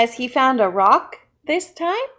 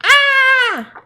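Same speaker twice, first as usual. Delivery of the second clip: high, loud shriek; freaked-out scream; 7 dB louder than usual. Acted. Bird is going to eat the frog, freaked-out scream. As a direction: shriek in storybook terror. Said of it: "like freaking out".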